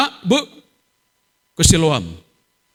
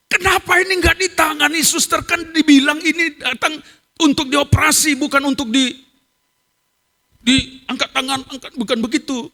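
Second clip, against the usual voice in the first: high-pitched indignant scolding; re-enacted anger; 11 semitones higher than usual. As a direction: take the nurse to task, voice raised high. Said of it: "betul-betul marah". (omelan marah dengan nada tinggi)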